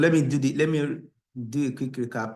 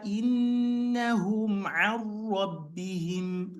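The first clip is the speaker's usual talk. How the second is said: chanted, higher, emphasizing idgham; recap recitation; 7 semitones higher than usual. Shown as a demonstration. Teacher's recap reading stressing idgham places. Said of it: "I'm just going to read it like that".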